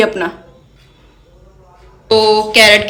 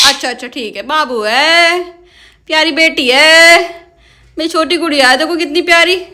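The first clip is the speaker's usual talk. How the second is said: sweet coaxing; 5 semitones higher than usual. Real, not acted. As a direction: coax the sulking parrot back tenderly. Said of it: "अच्छा डांटूंगी नहीं".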